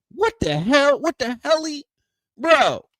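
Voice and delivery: imitating his own stunned yell, high and loud; outraged yell. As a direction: play it as shocked fury at a friend. Retelling his burning-nose outrage.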